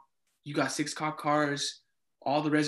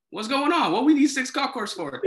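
higher-pitched, puzzled alarm; retold alarmed question; 9 semitones higher than usual. RA retelling seeing six cop cars, mock alarm. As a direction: imitate your own baffled reaction.

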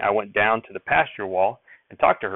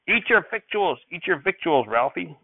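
higher, curt order from grandfather; snapping; 7 semitones higher, slower than usual. Acted. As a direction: snap the order at the boy. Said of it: "Grandfather snapped".

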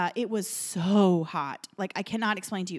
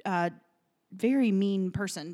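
ordinary talk

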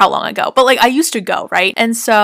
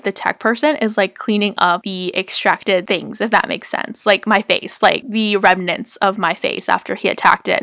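ordinary talk, nothing marked

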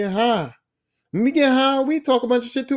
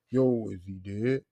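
mock slow dopey question; 13 semitones lower, 9 dB quieter, slower than usual.

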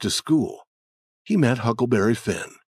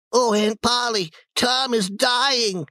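put-on alarmed child voice; 13 semitones higher than usual. Sid runs to aunt.

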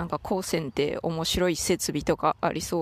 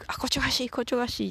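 higher-pitched mock reaction to being tickled; imitated ticklish exclamation; 6 semitones higher, much faster than usual. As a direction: blurt it out high, as if being tickled. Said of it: "くすぐられた時についつい". (くすぐったがる声の再現、高めに)

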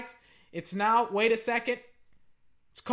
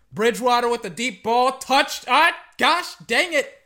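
excited play call, then frustrated; 8 dB louder than usual. Real, not acted. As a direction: call the big play, then curse the miss. Live call of a near touchdown.

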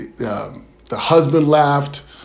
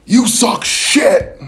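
heckler yell; 8 semitones higher, much slower than usual. Retold or imitated. Heckler in the club jeering the comic, slow and high. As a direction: drag out the insult like a heckler. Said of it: "someone busses out like".

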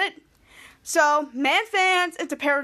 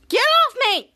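high, loud yell; shouted protest; 9 semitones higher, 6 dB louder, slower than usual. Retold or imitated. Charlotte to Ray sitting on her, high loud yell. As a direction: shove him off with your voice.

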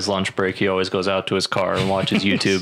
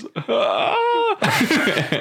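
high-pitched pained cry. Retold or imitated. Imitating kicked guy's high voice.

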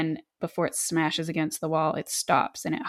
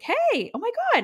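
squealed high, mock surprise at an old face; surprised greeting. Buffy spotting the risen vamp, squealing high in mock surprise. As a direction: play the shock of seeing him, pitched way up. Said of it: "And she's like".

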